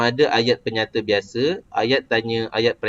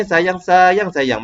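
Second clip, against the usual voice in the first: "sayang, sayang, sayang" high, falling at the end; expressing feeling; 5 semitones higher, 5 dB louder, much slower than usual. Shown as a demonstration. Teacher showing stated feelings, "sayang, sayang, sayang" high and emotive. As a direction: pour feeling into "sayang, sayang, sayang", pitched up. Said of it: "kita nyatakan perasaan kita pula".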